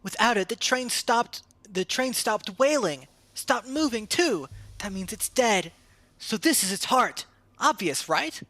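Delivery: put-on high child's voice, sure of itself; a know-it-all kid proving a point; smug kid voice. Child insisting it's a heart.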